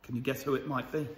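usual speaking voice

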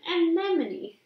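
plain reading voice

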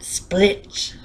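plain reading voice

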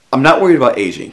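loud, high, quick protest; emphatic, hurried denial at high pitch; first attempt at the line. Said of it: "Let me get that right".

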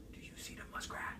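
quick whisper; hushed hunter. Retold or imitated. Hunter asks his partner about the muskrat, whispering. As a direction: whisper it so the prey won't hear.

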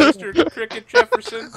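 mock the fake name; mocking cadet.

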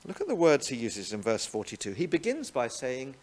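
usual speaking voice

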